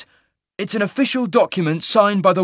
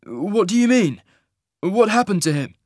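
higher, puzzled questions; pitched-up, baffled enquiry; concerned questioning; 10 semitones higher, 5 dB louder than usual. Acted. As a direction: ask with mild alarm. Hero questions the grieving baker.

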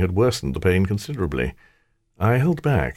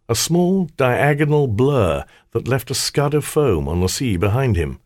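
ordinary delivery

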